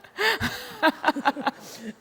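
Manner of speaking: high, loud burst of real laughter. Real, not acted. Laughing at her own story.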